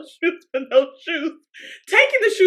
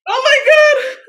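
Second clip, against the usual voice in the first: high, loud squeal after laughing; laughter-pitched wail; helpless exclamation; 11 semitones higher, 6 dB louder than usual. Real, not acted. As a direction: cry out, overcome with amusement. Recovering from helpless laughing fit.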